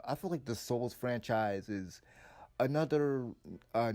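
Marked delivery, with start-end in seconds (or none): none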